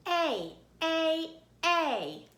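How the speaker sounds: A said as the letter name, like ate; long A sound. Shown as a demonstration. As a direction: hold out A as in ate. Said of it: "I like to do it like this".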